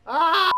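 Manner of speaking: wordless high, loud ah. Real, not acted. String of wordless ahs, last highest.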